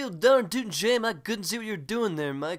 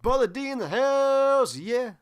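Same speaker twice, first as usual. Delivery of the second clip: slow, louder, higher hype call; hype announcement; 4 semitones higher, 4 dB louder, slower than usual. Acted. Host greets a guest he thinks is [name] with a loud, slow hype call. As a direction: shout him in like an MC.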